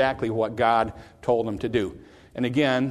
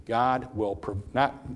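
ordinary talk

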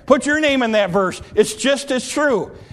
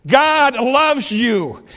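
ordinary talk

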